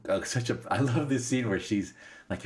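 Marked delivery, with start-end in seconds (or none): none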